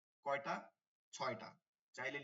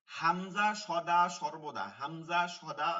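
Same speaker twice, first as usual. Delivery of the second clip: হামজা সদা সর্বদা drawn out slow and louder, every word leaned on; slow, louder teacher stress on Hamza সদা সর্বদা; slow emphatic stress; 7 dB louder, much slower than usual. Shown as a demonstration. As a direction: hammer home Hamza সদা সর্বদা slowly. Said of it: "teacher stresses the rule".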